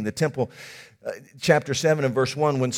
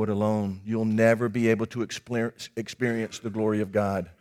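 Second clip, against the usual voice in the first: quiet preaching; 7 semitones lower, 7 dB quieter than usual. Acted. Quiet reflective sermon point.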